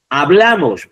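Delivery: "Hablamos" with a loud, high BLA; emphatic ha-BLA-mos; stress on penultimate syllable. Shown as a demonstration. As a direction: punch the middle of "Hablamos". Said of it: "otra vez".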